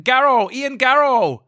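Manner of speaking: high silly guard voice; hammed-up searching soldier; high mock German soldier. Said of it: "Germans going out".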